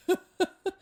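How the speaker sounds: sudden high laughing burst; burst of laughter. Real, not acted. Laughing at her own slow-motion yell. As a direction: laugh at yourself. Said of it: "It's not funny".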